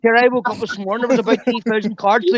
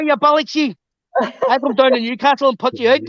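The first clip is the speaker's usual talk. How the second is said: normal talking voice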